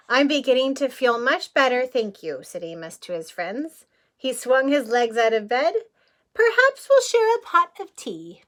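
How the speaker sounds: lively picture-book reading; story narration. A narrator's reading. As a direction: read it out to a child at storytime. Reading the book text aloud.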